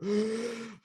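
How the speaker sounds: high, startled gasp at the cold air; mock gasp as the door opens; sharp gasp. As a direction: suck in a breath like cold air hits you. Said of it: "and I go".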